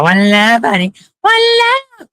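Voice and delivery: high sing-song call of One Love; imitating their greeting. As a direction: mimic the way they call out, pitch way up. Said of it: "They go".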